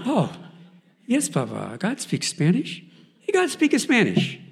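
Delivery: joyful son telling his father God speaks Spanish; excited reassurance. Retold or imitated. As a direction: cheer him on, loud and high.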